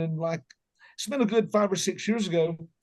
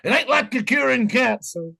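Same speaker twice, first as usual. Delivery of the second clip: dad's saying; 5 semitones higher, 3 dB louder than usual. Retold or imitated. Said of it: "my dad used to say this".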